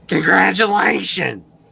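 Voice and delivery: high, slow, drawn-out congratulations; Roz voice. Retold or imitated. Notary ends the ceremony in Roz voice. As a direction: stretch it out flatly like a tired notary. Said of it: "that Roz voice that I will never forget".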